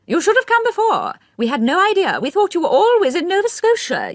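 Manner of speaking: put-on high, fluttery elderly aristocrat; old lady's voice. A narrator's reading. Lady Mondor welcomes.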